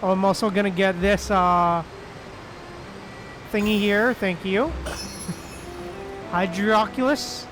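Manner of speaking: ordinary talk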